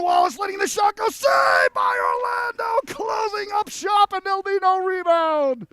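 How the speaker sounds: rising from quick to high, loud and drawn-out shouting; building to a shout. Real, not acted. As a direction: build up as he walks in, then explode on the save. Big chance and save, building call.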